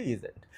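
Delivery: ইজেন্ট said as one joined word, not as is not; teacher stresses ইজেন্ট as one smooth word; contracted pronunciation. Shown as a demonstration. Teacher correcting students who split is not, joined contraction. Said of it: "is not এভাবে আলাদা করে বলি না".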